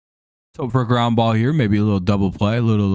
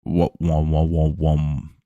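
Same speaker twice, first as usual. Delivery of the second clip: slow, drooping wah-wah sound; mock sad trombone; 5 semitones lower, slower than usual. Streamer mocks his team's bad play with sad trombone.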